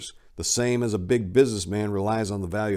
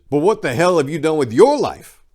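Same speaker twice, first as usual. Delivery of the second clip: louder, higher challenge; defiant retort; 6 semitones higher, 8 dB louder than usual. Real, not acted. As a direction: fire it straight at the critics.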